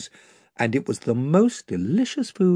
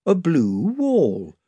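plain reading voice

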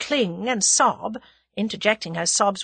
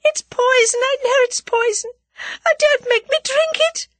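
high, loud terrified wail rising; desperate pleading at the top of the voice; frantic moaning; 7 dB louder than usual. A narrator's reading. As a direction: wail it in panic, far above your usual pitch. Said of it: "she moaned".

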